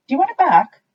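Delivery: very high, loud squeak; squeaky mouse. A narrator's reading. Mouse offers the tooth.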